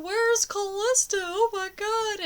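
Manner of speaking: high, loud, tearful cry for a missing daughter; reliving the panic, voice breaking high; retold sobbing panic. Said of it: "And I'm sobbing".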